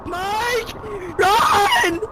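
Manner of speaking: yelling run in panic; panicked shout. Real, not acted. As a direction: shout a warning to flee. Fleeing a monster.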